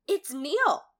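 gasp, then draw the reveal out slowly; surprised gasp. Acted. Recapping Emma's shock at finding Neil, surprised. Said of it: "is surprised to realize".

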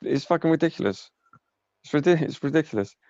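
high-pitched exasperation; exasperated. Real, not acted. Gamer fed up with empty game hype, exasperated. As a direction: protest it, voice pitched right up. Ranting at hype without gameplay.

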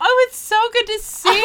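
high, loud gushing greeting; sugary overexcited fan voice; gushing stranger. Retold or imitated. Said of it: "and she kept going".

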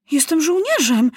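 put-on high-pitched boyish protest; indignant cry. A narrator's reading. Maciuś protesting to the enemy officer, crying out. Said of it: "krzyknął Maciuś".

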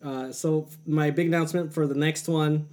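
talking while eating; words round a bite of food; mouth full. Real, not acted. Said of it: "sorry I'm eating".

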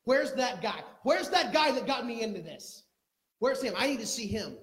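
high, loud, urgent demand; grieving mother loudly demanding the prophet; desperate demand. Acted. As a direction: call out for the man who got her into this.

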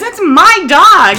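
high, slow, loud indignant owner; indignant lady. Retold or imitated. Owner catching a stranger taking her dog, slow indignant. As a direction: claim the dog flatly. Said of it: "She goes".

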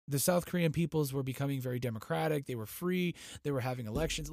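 normal talking voice

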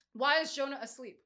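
usual speaking voice

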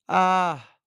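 high loud echoed yelp; the mountain echoing the boy's yell; echo of the cry. A narrator's reading. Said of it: "oyó una voz repitiendo".

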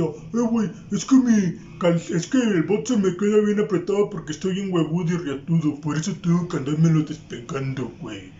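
lightly voiced bragging buddy; a hint of a boastful friend; mild imitation of a show-off; mocking the friend. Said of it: "Y siempre decía".

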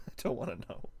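fast, breathy whisper. Real, not acted. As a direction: whisper it to yourself. Repeated under breath.

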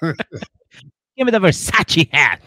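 drawn-out mock boast, high and loud; mock rich demand. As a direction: stretch it out like a spoiled star.

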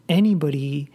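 ordinary talk, nothing marked

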